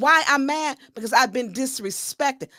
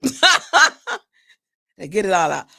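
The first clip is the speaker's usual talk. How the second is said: "Did I get it all out?" said slowly and louder through laughter; laughing repeat; 3 semitones lower, 5 dB louder, slower than usual. Real, not acted. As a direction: ask it again, cracking up at yourself.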